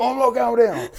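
high, loud enraged yell; furious mock shout. Angry father yelling at the crowd while pinned, raging. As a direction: howl it like a raging dad. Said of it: "acted quarrel".

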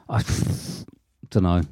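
normal talking voice

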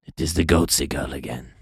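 low and soft, tired of this customer; weary muttered sigh. Retold or imitated. Fed-up pasta man sighing at a regular customer, low and soft. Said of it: "he'd be like".

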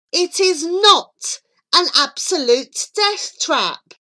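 high, slow, emphatic contradiction; exasperated, drawn-out insistence; insistent denial. A narrator's reading. Flora contradicts Charlie.

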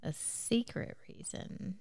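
slow, hushed, mysterious; secretive whisper. Acted. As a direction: breathe it as a secret. Repeats phrase in whisper.

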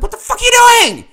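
high, loud outburst; startled yell. Retold or imitated. Catching his ex in his kitchen, shocked high yell. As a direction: yell it like you just caught an intruder.

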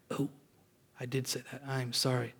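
very soft, sheepish apology; hushed retold realization; contrite admission. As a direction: own up quietly, almost to yourself. Retells his contrite reply to God.

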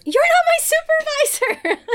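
high, loud bratty yell; defiant mock shout. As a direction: shriek it like a defiant brat. Acts Lily's defiant retort.

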